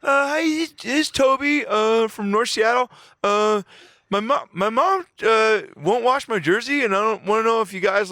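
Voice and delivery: put-on high, slow little kid on the phone; kid caller voice. Prank caller plays a little kid asking the radio hosts for help, high and slow. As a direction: play a helpless young boy calling the radio, high and slow. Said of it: "I call right back".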